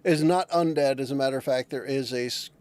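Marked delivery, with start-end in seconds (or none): none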